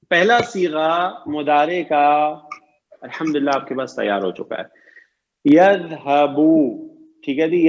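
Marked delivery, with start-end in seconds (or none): 5.4-6.9 drawn-out teacher demo; slow drawn model word. Shown as a demonstration. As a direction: stretch يذهبو slowly: يذ-ہ-بو with a long final و. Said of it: "teacher models the verb form".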